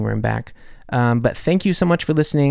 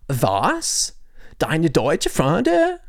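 put-on Valley Girl accent; 4 semitones higher, much slower than usual. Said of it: "with a Valley Girl accent".

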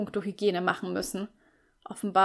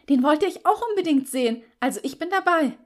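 higher and louder, a bit too eager; overly enthusiastic; 8 semitones higher, 7 dB louder than usual. A narrator's reading. Backing Steffi's film idea, overly enthusiastic. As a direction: oversell the excitement on purpose. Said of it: "etwas zu enthusiastisch".

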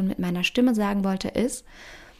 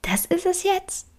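disillusioned doubt; 4 semitones higher than usual. Retold or imitated. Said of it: "mal sagen".

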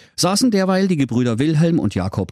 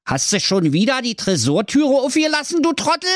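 much higher, exasperated scolding. Acted. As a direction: tell off the careless brother. Jakob scolds his brother. (genervtes Schimpfen)

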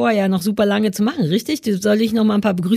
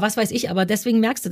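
usual speaking voice

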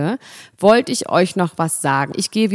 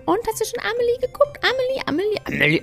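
very high, singsong pestering voice; nagging fan. Retold or imitated. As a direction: squeal it like an excited fan. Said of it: "wo alle immer gefragt haben".

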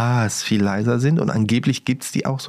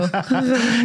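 high laugh; 12 semitones higher than usual. Real, not acted. Laughs at the story.

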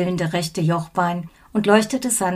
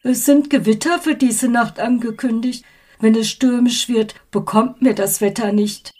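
a higher, louder fretful man; anxious ghost man; 4 semitones higher, 4 dB louder than usual. A narrator's reading. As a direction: worry aloud about the weather. Anxious ghost fears storm.

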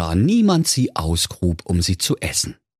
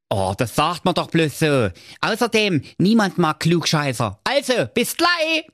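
put-on high cheeky young lad; chirpy, pitched-up hero voice; Schnitzelschorsch voice; 8 semitones higher than usual. A narrator's reading. Narrator voicing Schnitzelschorsch, a cheeky high voice. Schorsch replies.

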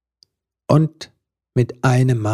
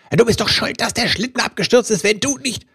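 much higher, fast angry accusation; furious shouting; 10 semitones higher than usual. A narrator's reading. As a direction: rant and blame her, rushing. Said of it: "schrie er".

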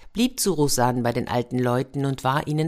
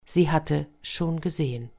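plain reading voice

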